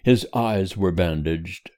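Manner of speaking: ordinary delivery, nothing marked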